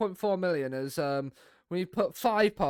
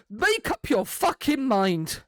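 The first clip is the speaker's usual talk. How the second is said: slow, slightly louder exasperated yell at the player; exasperated outburst; 4 semitones higher, 4 dB louder, slower than usual. Acted. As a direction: spell out your frustration word by word.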